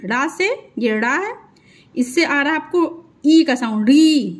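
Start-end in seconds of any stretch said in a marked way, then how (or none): 3.9-4.4 री with a long held "ee"; stretched ई. Shown as a demonstration. Teacher showing the big ई matra, री pulled long. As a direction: drag out the vowel in री. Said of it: "देखिए खींच करके".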